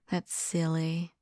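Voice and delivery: soft laugh, then quiet words; amused laugh. Acted. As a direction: laugh it off softly. Laughs at own idea.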